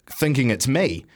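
ordinary talk

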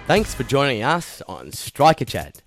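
upbeat podcast outro, Aussie; cheery sign-off read, Aussie host; aussie tradesman. Acted.

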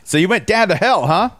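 high-pitched mock Pittsburgh accent; put-on Pittsburgh accent. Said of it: "That is the accent".

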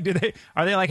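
ordinary talk

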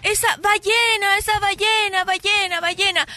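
put-on drunk crying alarm. Little drunk warns the beach, mock yelling. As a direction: repeat it like a half-hearted alarm cry. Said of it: "empieza a gritar".

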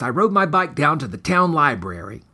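plain reading voice

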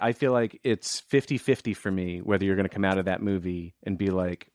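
normal talking voice

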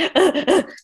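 high, loud startled gasp; a sudden panicked intake of breath; sharp gasp. Retold or imitated. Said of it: "I'm like".

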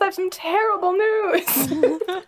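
high, slow teasing delivery; mock bad-news voice. Game master tells undead-hating player about the crypt, high mock sympathy.

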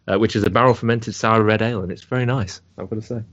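ordinary talk, nothing marked

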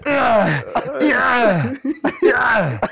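loud, high grunted yeah in Arnold's voice; hammed-up Arnold moaning mid-sex; mock Schwarzenegger groan, loud and high; Arnold grunting. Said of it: "Just Arnold having sex".

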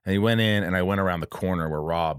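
usual speaking voice

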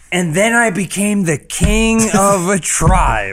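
high, slow grand finale; drawn-out, self-glorifying climax; triumphant storyteller. Acted. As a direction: bring the legend to a pompous close. Climax of the king's tale.

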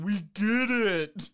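loud slow exasperated cry; exasperated shout. Acted. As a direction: drag it out in mock exasperation. Mock exasperation.

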